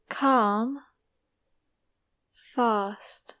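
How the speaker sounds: long open ah, as in standard British bath; British long AH vowel. Shown as a demonstration. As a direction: drill each word with a long British ah. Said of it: "standard British English pronunciation".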